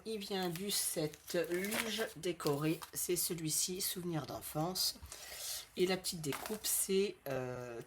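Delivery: normal talking voice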